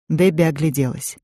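plain reading voice

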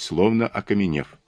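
ordinary delivery, nothing marked

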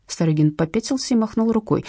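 ordinary delivery, nothing marked

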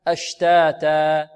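slow أشتاتا, two equally long vowels; مد طبيعي. Shown as a demonstration. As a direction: measure both alifs in أشتاتا evenly. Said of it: "وكيف نضبط المد الطبيعي". (أشتاتا بمدين متساويين)

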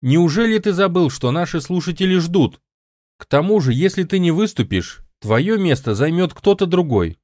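high, pressing appeal; insistent. A narrator's reading. Woman reminds the host that listeners are waiting, insistent. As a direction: keep pushing, pitch held well up. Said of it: "настаивала Пегги".

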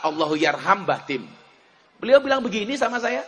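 normal talking voice

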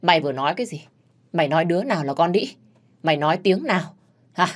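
usual speaking voice

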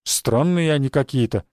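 ordinary delivery, nothing marked

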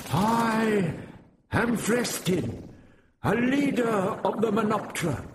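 high, lofty proclamation; haughty leader. A narrator's reading. Vrestin proclaims himself proudly to the guards. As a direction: announce yourself like royalty. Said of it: "staring haughtily around him".

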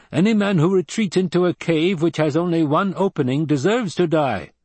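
high-pitched, matter-of-fact tribesman voice; Fremen desert fighter, blunt. A narrator's reading.

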